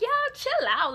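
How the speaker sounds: high exasperated "chill out"; telling them to chill. Retold or imitated. Breaking up her cousins' fight, high and exasperated. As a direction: pitch way up, fed up with the fighting. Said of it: "I'm like".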